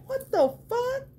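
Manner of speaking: high shocked rising cry; shocked squeal. Retold or imitated. Seeing the teeth on the figure, high shocked squeal. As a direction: squeal at how detailed it is. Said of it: "I'm like".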